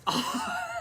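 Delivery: a short, high laugh at his own bad luck; high rueful laugh. Real, not acted. Laughing ruefully at his bad luck. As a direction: laugh at how bad it got.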